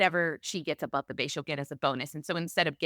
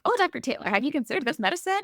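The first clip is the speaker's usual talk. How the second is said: a much higher, bright-voiced trainee asking her attending; resident's eager question; 9 semitones higher, faster than usual. Resident asking Doctor Taylor about a medicine, high eager voice. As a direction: play the keen resident checking the doctor.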